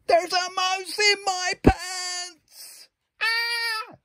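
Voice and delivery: very high slow shriek; panicked scarecrow. Acted. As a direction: yelp it in alarm.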